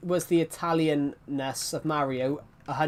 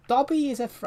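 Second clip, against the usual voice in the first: lightly raised elf voice; half-tried higher elf voice; Dobby voice; 9 semitones higher, 4 dB louder than usual. Pretend. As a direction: start a Dobby squeak and give up. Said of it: "I can't do Dobby".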